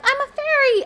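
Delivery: high, mock-airy voice of a costumed adult; mocking partygoer. Mocking adults in skimpy Halloween costumes, high mock voice. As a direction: sneer at the fairy costume. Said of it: "they're like".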